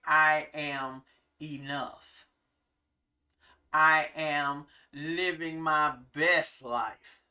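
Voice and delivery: slow confident affirmation; affirming, slow and sincere. Shown as a demonstration. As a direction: say it like you mean it. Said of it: "You can say".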